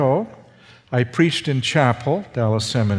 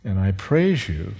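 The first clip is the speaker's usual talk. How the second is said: closing prayer; 4 semitones lower than usual. Acted. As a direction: pray it humbly and tenderly.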